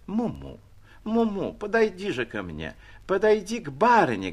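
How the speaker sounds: выше, ласково подманивая; барыня ласково подзывает испуганную собачку; ласковый подзыв. A narrator's reading. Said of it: "говорила госпожа". (ласковое сюсюканье)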